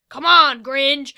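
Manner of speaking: loud commanding yell. Acted. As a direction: bark the order at the giant. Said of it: "Fido yelled".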